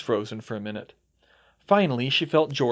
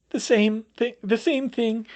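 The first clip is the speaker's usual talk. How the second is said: crying; 11 semitones higher, 4 dB louder than usual. Acted. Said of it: "Septima started crying".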